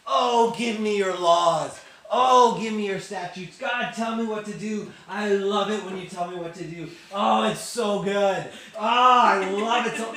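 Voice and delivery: loud, high, hammed-up rapture; ecstatic David. Youth leader acts out David craving God's laws, mock ecstasy. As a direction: cry out in over-the-top delight, stretching the words. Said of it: "he talks like this the whole time".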